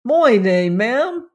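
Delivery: put-on loud Cockney boy, pitch swinging up; undampened Cockney eagerness; enthusiastic Cockney. A narrator's reading. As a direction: answer eagerly in broad Cockney. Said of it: "did not, however, dampen the enthusiasm".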